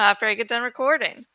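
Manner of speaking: ordinary delivery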